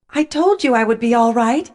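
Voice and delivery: cheerfully proving a point; cheerful proclamation. A narrator's reading. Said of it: "proclaimed cheerfully".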